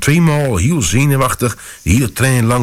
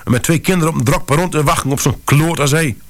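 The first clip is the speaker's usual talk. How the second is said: furious; 8 semitones higher than usual. A narrator's reading. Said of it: "Mevrouw was razend".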